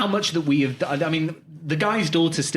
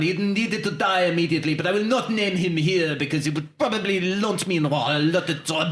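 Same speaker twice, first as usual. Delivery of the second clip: emphatic French customer. Pretend. French caller refusing to name his target, emphatic accent.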